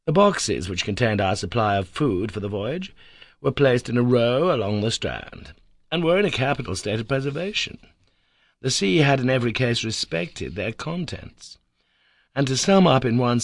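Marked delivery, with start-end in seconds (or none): none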